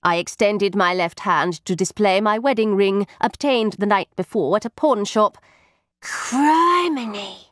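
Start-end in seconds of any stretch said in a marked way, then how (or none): 6.0-7.5 high, drawn-out astonished exclamation; amazed boy. A narrator's reading. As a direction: gasp it out like a wide-eyed lad. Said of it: "exclaimed Joddy".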